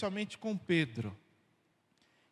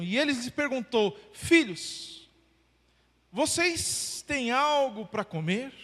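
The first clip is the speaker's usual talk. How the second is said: higher, louder storytelling read of the Gospel; scripture reading; 5 semitones higher, 7 dB louder than usual. Acted. Pastor reading the lakeside scene aloud, lifted and lively. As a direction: carry the church through the fishing scene.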